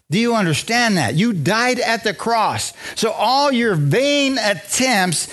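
high, insistent pulpit delivery, stretching key words; emphatic preaching. Real, not acted. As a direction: drive the point home to the congregation, pitch climbing. Preaches with rising intensity.

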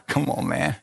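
ordinary delivery

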